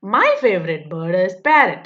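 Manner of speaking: slow, careful reading of the question; textbook read-aloud. Acted. Teacher reading the workbook question to pupils, slow and deliberate. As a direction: read each word out slowly for young pupils. Reads model answer.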